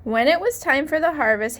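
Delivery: usual speaking voice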